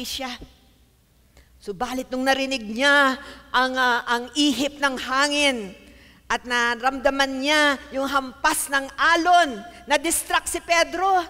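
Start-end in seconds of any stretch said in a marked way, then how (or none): 1.6-11.2 loud preaching, climbing in pitch; forceful preaching. Real, not acted. Preacher telling of Peter's distraction, preaching at full force.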